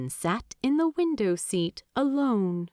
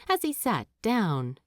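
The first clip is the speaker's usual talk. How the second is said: usual speaking voice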